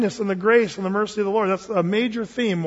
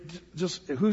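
normal talking voice

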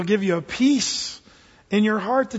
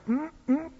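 high, quick approving "mm"; churchy hum of agreement; congregation hums; 6 semitones higher, 7 dB quieter than usual. Retold or imitated. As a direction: imitate a crowd murmuring assent. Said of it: "the crowd in the background".

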